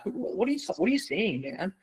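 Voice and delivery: mock bafflement. Coach reacting to textbook ethics talk, mock bafflement. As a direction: react in disbelief, pitch lifted.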